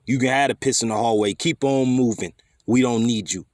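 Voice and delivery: usual speaking voice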